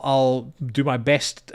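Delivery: ordinary delivery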